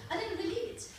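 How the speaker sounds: normal talking voice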